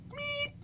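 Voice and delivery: very high electronic meep; high beep noise. Retold or imitated. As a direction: imitate a tone sound. Imitating the tone.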